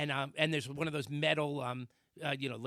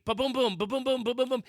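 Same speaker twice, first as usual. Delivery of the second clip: high, loud rhythmic booms; imitated thumping; 7 semitones higher, 5 dB louder than usual. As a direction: drum out the repeated thuds. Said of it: "So this goes on".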